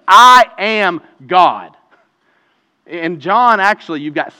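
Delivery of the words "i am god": louder, very slow, every word hammered; slow booming declaration. Retold or imitated. Preacher voicing Jesus' claim to the crowd, slow and booming. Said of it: "he's also saying".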